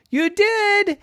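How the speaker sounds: very high-pitched disbelief; amazed kid. Acted. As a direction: squeal it in astonishment. Jackson amazed at Miles's claim.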